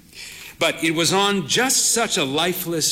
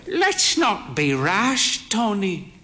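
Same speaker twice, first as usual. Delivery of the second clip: put-on a slow, higher old lady's voice; frail old mother; 6 semitones higher, slower than usual. A narrator's reading. Old mother cautioning her son Tony, slow and high. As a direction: gently rein him in, like a proud frail mother.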